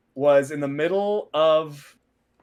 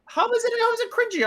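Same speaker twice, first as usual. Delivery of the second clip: high-pitched defensive question; indignant protest; faster than usual. Real, not acted. Defending his old speech to a cringing co-host, indignant. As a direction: squeak it out, put on the defensive.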